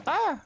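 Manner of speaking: sharp high yelp of pain. Real, not acted. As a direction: yelp like you stubbed a toe. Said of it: "I stubbed my toe again".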